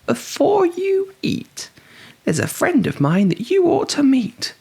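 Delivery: clever little mouse bargaining; high squeaky mouse. A narrator's reading. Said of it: "said the mouse".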